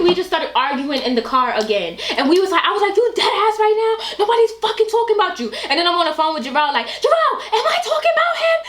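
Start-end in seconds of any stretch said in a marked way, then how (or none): 2.9-5.5 high-pitched mock argument; retold arguing. Friend retelling a car argument, arguing. 6.9-8.6 very high, incredulous mock appeal; retold arguing. Appealing to Jarrell on the phone, arguing.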